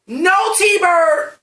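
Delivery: high, loud outburst; \"T-Bird\" yelled high and loud; indignant shout. Real, not acted. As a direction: shout it like an outraged fan. Ranting about plot rules.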